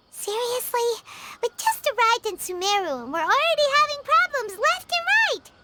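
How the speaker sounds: ordinary talk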